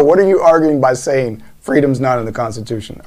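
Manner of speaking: usual speaking voice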